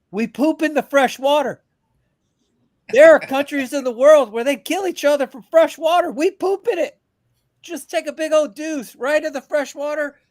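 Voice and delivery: high, loud incredulous rant, building; rising rant. Real, not acted. Host ranting to guests about Americans, high incredulous rant. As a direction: push it up into disbelief at wasted water.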